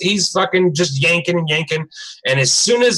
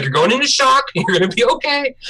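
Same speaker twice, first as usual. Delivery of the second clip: imitated panicked reassurance; 9 semitones higher than usual. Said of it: "he's, like, instantly panicked".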